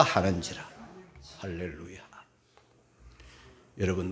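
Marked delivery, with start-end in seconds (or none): 1.4-2.0 whispered hallelujah. Real, not acted. Hushed aside after the reading.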